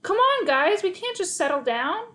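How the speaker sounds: loud, high wailing plea; frustrated high wail; howled. Acted. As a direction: howl at the dogs. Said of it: "I howled".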